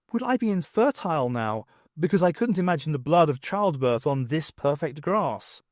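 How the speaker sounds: ordinary delivery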